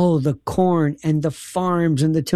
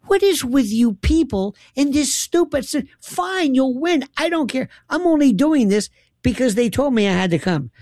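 higher, fed-up mock tirade at the rival; exasperated rant; 7 semitones higher than usual. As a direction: huff it out like you've had enough of these people.

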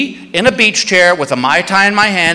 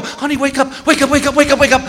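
urgent wake-up; 8 semitones higher, faster than usual. Retold or imitated. Waking sleeping wife in panic.

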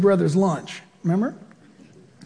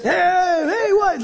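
mock lion heckling; 9 semitones higher, much slower than usual. Lion shouting imitated.

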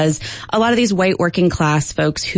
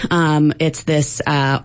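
normal talking voice

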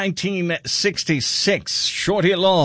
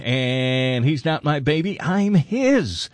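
usual speaking voice

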